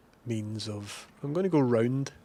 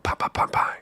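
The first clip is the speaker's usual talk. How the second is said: whispered gunshots; 3 dB louder than usual. Pretend. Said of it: "being like".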